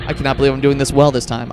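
normal talking voice

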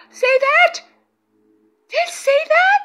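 high, loud, amazed question; excited. Real, not acted. Medium asking viewers about an orb, excited. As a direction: check excitedly that they saw it too.

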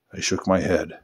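usual speaking voice

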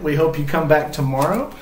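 plain reading voice

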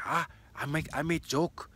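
imitating Japanese accent. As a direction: play the waitress laughing it off in a put-on Japanese accent.

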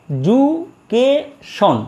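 এজুকেশন very slowly, in four beats; syllable by syllable. Shown as a demonstration. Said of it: "চারবার stroke করছে".

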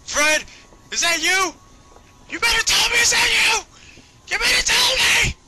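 very high, loud, drawn-out wail; hammed-up desperate shouting; mock anguished scream. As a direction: scream it like an overacted movie breakdown. Hammed-up movie scene with Fred.

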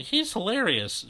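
high, slow forced reply; faint agreement. Acted. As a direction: stretch it out uncertainly. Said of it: "she says faintly".

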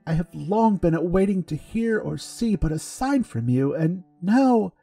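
high, close to tears; crying. A narrator's reading. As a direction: voice welling with tears. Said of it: "he was definitely crying".

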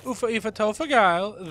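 lightly put-on effeminate Northern German accent; Eurotrash German. Showing how German can sound effeminate, mock Northern German accent. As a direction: toss off nonsense German like a Eurotrash type. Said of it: "a Eurotrash impression that just sounds".

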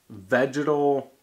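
ordinary delivery

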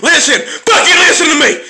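high, insistent shout; worked-up ranting command; angry demand. Real, not acted. Demands that viewers listen to him.

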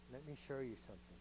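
soft, kindly invitation; gentle God. Retold or imitated. God gently correcting his child, soft and kind. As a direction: coax like a patient father. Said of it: "He's gonna say".

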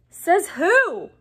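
high, loud retort; loud challenge. A narrator's reading. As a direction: challenge it loudly. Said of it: "said Dean Thomas loudly".

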